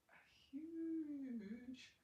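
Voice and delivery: lightly put-on Scottish accent. Shown as a demonstration. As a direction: try out a Scottish accent on one word. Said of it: "trying to get my Scottish accent back".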